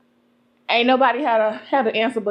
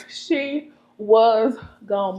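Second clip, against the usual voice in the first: very slow, stretched words; drawn out; much slower than usual. Acted. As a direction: stretch every word out. Repeats for emphasis, drawn out.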